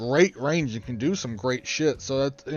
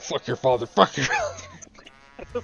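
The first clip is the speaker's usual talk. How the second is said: crab voice; slower than usual. Retold or imitated. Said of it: "this crab, and he was all like".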